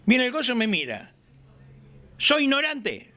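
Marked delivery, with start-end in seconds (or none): 2.2-3.1 high-pitched half-shout. Retold or imitated. Old master half-shouting at his apprentice, high mock yell. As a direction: bark out the rebuke, half yelling. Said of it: "me dice con voz así medio gritando".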